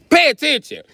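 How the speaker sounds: high, loud snap to the class; teacher calling the class to order. Retold or imitated. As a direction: bark it like a fed-up teacher.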